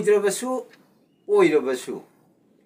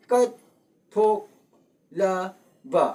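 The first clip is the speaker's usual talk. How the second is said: ꯀꯠꯊꯣꯛꯂꯕ in separate syllables with long pauses between; 2 semitones higher, much slower than usual. Shown as a demonstration. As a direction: break ꯀꯠꯊꯣꯛꯂꯕ apart very slowly, one beat at a time. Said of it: "ꯑꯍꯨꯝꯁꯨꯕ". (ꯀꯠꯊꯣꯛꯂꯕ ꯈꯣꯟꯊꯣꯛ ꯈꯨꯗꯤꯡ ꯇꯣꯉꯥꯟꯅ ꯇꯞꯅ ꯍꯥꯏꯕ)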